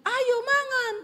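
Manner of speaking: very high, loud call ending on a rising tone; Sulawesi high tone. Retold or imitated. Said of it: "nada orang Sulawesi".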